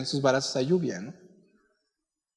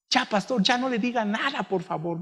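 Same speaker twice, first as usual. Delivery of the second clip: higher, lightly voiced girl begging; a hint of a girl begging the pastor, pitched higher; pleading girl; 4 semitones higher, 3 dB quieter than usual. Girl begs pastor to stop.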